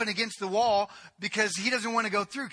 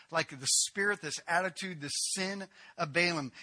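normal talking voice

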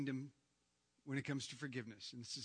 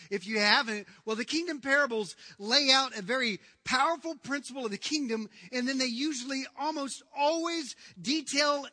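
raised, higher preaching voice; forceful preaching; 8 semitones higher, 10 dB louder than usual. Acted. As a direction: preach it out with big emphasis on key words.

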